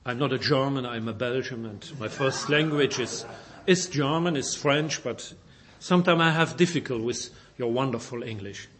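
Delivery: ordinary delivery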